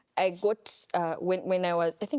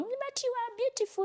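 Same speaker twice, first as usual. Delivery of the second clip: high, soft coaxing voice of a parent; mock parent reassurance; 5 dB quieter than usual. Retelling family's comfort, mimicking parent in a high soft voice. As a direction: sweetly reassure the child, high and hushed.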